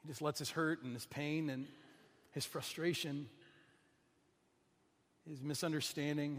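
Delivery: ordinary talk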